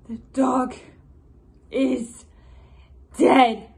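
emphatic, word by word, loud finish; Queen Margaret. Acted. Vengeful queen pronouncing her enemy dead, loud and deliberate. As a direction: land each word separately, hardest on the last. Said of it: "a portion of Queen Margaret's monologue".

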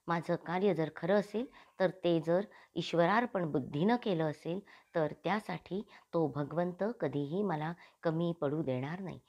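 ordinary delivery, nothing marked

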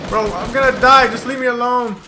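loud panicked gamer plea; panicking gamer. Real, not acted. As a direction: beg while being chased. Fleeing, about to die.

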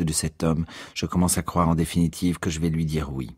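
low, measured self-important voice; lofty, low-pitched monotone; pompous, condescending Ignatius. Acted. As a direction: decline grandly, as if far above the offer. Plays self-important Ignatius at length.

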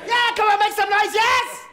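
shouting for the crowd to make noise; rallying the crowd. Real, not acted. Urging crowd to cheer.

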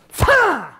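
high, loud burst of a shout; sharp kiai shout. Shown as a demonstration. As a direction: snap it out as you strike. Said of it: "like you're gonna sprint".

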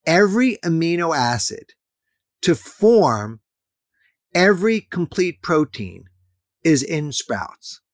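deliberate phrase-by-phrase delivery with pauses; slow it down so every part lands. Shown as a demonstration. Guest repeating his key point for the host, slowly and deliberately. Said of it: "and I'll say it slower".

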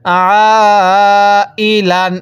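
very long held "আ", then short "ই, লান"; drawn-out vowel. Shown as a demonstration. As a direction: sustain "আ" before finishing "ই, লান". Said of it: "এরকমভাবে শিখব".

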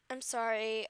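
ordinary talk

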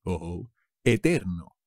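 usual speaking voice

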